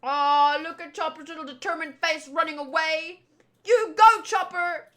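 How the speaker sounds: ordinary talk, nothing marked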